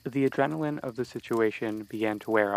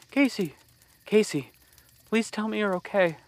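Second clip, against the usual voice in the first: much higher frightened calls of a name; shaky, frightened calling; 9 semitones higher than usual. Acted. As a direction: call out in panic over a fallen friend. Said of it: "Shayna's voice was shaky".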